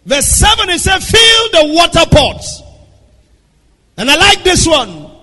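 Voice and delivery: 힘차게 설교하는 목소리; full-force preaching. Acted. Preacher reads the verse aloud.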